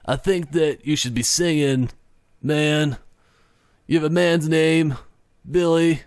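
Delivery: lightly voiced lazy stoner drawl; a hint of a laid-back stoner. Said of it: "I don't know why I'm going into stoner voice".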